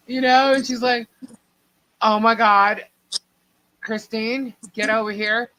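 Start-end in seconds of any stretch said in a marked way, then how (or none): 2.0-5.5 mock angry call. Mother calling the cousin.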